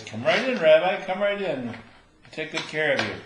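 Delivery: sing-song come-on greeting; eager madam. Acted. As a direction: roll out the welcome like a brothel madam. Madam welcomes rabbi at door.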